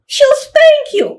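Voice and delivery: put-on very high, slow, loud childish threat; sing-song tattletale child; little girl's voice. Sister threatening the other with mama's spanking, high child voice. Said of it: "make it sound like a children's voice".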